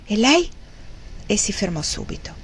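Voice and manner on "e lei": sudden higher, rising question; exclaimed question. A narrator's reading. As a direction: blurt out an urgent question. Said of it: "il signor Thornton esclamò".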